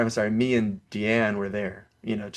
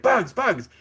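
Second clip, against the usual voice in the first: warning; 7 semitones higher, slower than usual. Retold or imitated. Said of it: "everyone's like".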